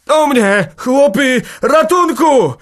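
very high, loud cry for help; desperate bursts at full force; terrible shout. A narrator's reading. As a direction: scream for the men to come. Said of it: "krzyknął naraz strasznie".

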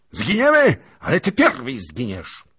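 very high, loud vengeful cry; furious threat. Acted. Prince shrieks threat at the lieutenant. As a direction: promise his death.

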